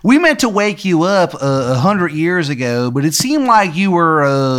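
hammed-up Maryland local explaining himself; terrible Maryland accent. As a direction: put on a bad Maryland accent. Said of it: "he's got, like, a terrible Maryland accent".

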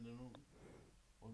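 ordinary talk

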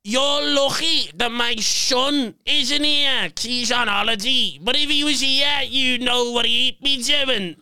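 high, drawn-out imitation of an irate woman; mock outraged mother; angry heckler's threat.

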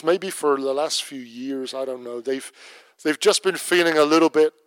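pastoral prayer. Acted. Closing prayer over congregation.

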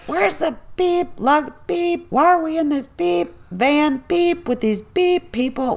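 high-pitched imitation of a swearing rant with sung-out beeps; retold tirade, voicing each censor beep high; mock bleeped-out cussing. Mom cussing like a sailor off the flight, imitated high-pitched rant. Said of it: "she's just cussing like a sailor".

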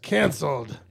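loud exclaimed Canceled!; emphatic shout. Shown as a demonstration. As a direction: punch out the word like a headline.

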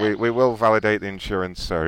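ordinary talk

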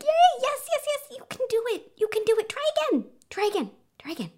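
high-pitched excited cheering, easing off at the end; cheerleader for toddler. Acted. Mother urging her toddler to walk, excited high-pitched cheering. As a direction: cheer them on in a high, giddy voice. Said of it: "or a cheerleader for him".

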